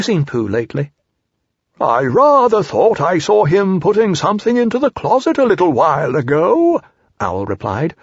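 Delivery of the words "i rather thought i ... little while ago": put-on higher, louder, self-important old owl; pompous Owl. A narrator's reading. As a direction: hold forth airily. Owl voiced in story.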